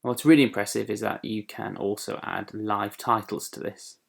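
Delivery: ordinary talk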